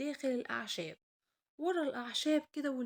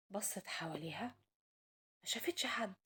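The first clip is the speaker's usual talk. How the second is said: hushed storyteller whisper; 2 semitones lower, 7 dB quieter than usual. A narrator's reading. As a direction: breathe it out for suspense.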